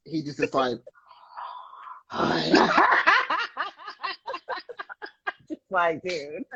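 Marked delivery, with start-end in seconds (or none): 2.1-5.1 high burst of laughter; big laugh. Real, not acted. Laughing at her child's comment about chicken.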